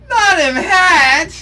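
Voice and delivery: high, loud, slow mock cry; mock wail. Viewer pretending to grieve the hat, mock wail. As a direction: ham up the despair over the hat.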